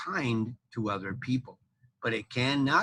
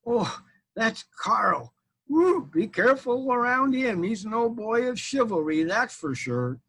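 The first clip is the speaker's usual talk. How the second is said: wary warning; 8 semitones higher than usual. Retold or imitated. Classmate warning others about Carl, wary. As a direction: warn a friend off. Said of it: "say my name like this".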